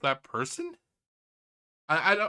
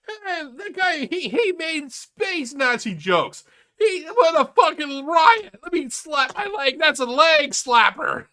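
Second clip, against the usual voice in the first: much higher, slow, sarcastic gushing with a laugh; mock the fans praising a terrible joke; 10 semitones higher, 7 dB louder, slower than usual. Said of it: "everybody like".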